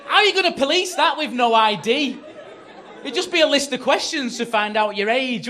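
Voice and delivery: high, shouted comic tirade; outraged rant. Acted. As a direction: rage at full volume in disbelief. Acted outraged rant about night worker.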